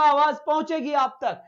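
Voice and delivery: teacher calling out over a poor connection; teaching loudly. Real, not acted. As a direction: raise your voice so every student hears. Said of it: "voice में तेज चिल्लाऊंगा".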